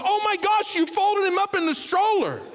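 very high, loud shocked cry; a wife realising the baby is folded in the stroller; horrified realisation. Retold or imitated. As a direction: gasp it out in horror.

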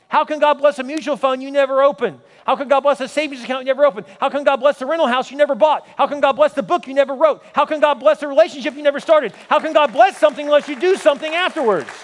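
rising sermon litany, punching every question; preaching at full force. Acted. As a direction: drive each "How can God bless" home like a pulpit crescendo. Repeated preaching build-up.

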